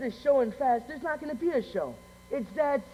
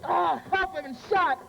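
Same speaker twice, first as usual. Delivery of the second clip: high-pitched cry for help; panicked cry; 6 semitones higher, 4 dB louder, slower than usual. Acted. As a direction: cry out as if just shot. Cries out after gunshot.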